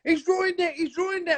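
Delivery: put-on very high, whiny little girl cry; wailing schoolgirl. Imitating Bernadette wailing to her teacher mum. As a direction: wail it like a tattling child.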